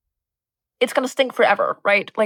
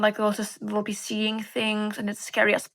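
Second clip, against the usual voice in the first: usual speaking voice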